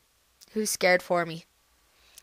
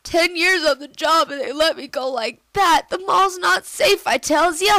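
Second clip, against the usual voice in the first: a hint of a grumbling old guard, higher and louder; lightly voiced old guard; 7 semitones higher, 5 dB louder than usual. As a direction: play the fired guard complaining. Voicing sacked security guard Gus.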